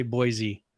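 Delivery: put-on "Boise" with a Z, BOY-zee; outsider's pronunciation. Shown as a demonstration. Local shows how outsiders say it, "Boise" as BOY-zee. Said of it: "if you're not from here, you would say".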